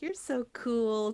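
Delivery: mock adoring little sister, drawn out. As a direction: gush like a kid sister in awe. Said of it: "that adoring little sister energy".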